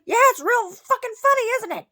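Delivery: high, loud sarcastic yell; retold angry shout. Retelling how they answered the laughing woman, angry sarcastic shout. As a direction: re-enact snapping back at top volume. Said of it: "I finally said".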